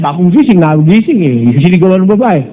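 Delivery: ordinary delivery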